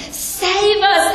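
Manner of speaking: high pleading cry; crowd pleading. Retold or imitated. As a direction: lift the pitch like a crowd begging. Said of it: "they're saying".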